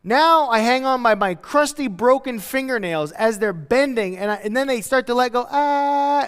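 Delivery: ordinary talk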